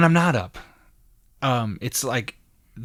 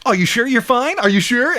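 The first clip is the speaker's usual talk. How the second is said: pushy concern; 9 semitones higher than usual. Retold or imitated. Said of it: "I'm not gonna be like".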